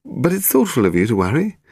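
high, politely evasive reassurance. A narrator's reading. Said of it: "he sounded evasive".

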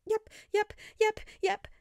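high, rapid nervous yeps; stuttered. A narrator's reading. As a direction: stammer in fright. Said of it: "Peter stuttered".

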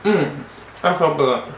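chewing while talking; talking around food; mouth full. Real, not acted. Seen 2: Fork to mouth at -1; lips closed, jaw working while speaking.

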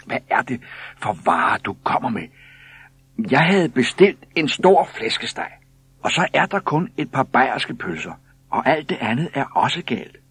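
loud, high-pitched, indignant telling-off; scolding woman. A narrator's reading. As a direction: scold him like a fed-up wife. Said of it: "der skældte og smelte".

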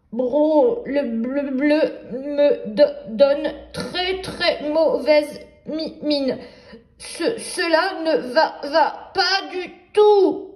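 mock teeth-chattering, stuttering and half laughing; a frozen wolf stammering through the cold, hammed-up; shivering wolf. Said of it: "En claquant des dents".

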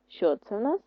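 ordinary talk

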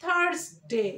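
THURSDAY as "THARS-day", r sounded. Shown as a demonstration. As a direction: say থার্সডে with r and s. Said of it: "আমরা কি বলি?".

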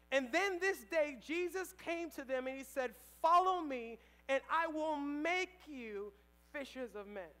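loud, rising preacher's call; sermon voice at full force; preaching. Acted. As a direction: preach it out to the room.

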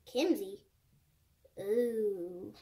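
ordinary delivery

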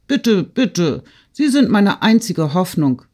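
put-on high-pitched, pleading boyish call; eager calling out. A narrator's reading. Boy begs Peter for news about the hussars, calling eagerly. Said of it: "rief Petja".

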